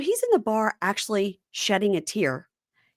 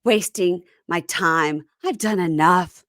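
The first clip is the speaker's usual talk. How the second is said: slow, weighty theatrical delivery; mock melodrama; 3 semitones lower, slower than usual. Imitating a drunken woman ending a fight, melodramatic. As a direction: drag out each phrase like a weary drama queen.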